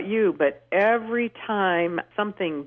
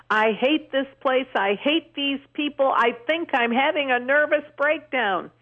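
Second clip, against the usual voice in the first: higher, mock distraught outburst; a hint of a desperate woman's rant; upset stranger; 7 semitones higher than usual. Retelling a sobbing stranger in a prison restroom, mildly frantic. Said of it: "She was sobbing. She was cussing".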